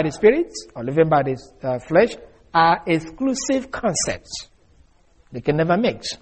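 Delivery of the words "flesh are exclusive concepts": usual speaking voice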